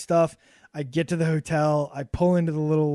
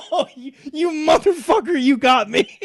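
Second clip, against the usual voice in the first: laughing; 9 semitones higher, 8 dB louder than usual. Retold or imitated. Tricked by April Fools joke.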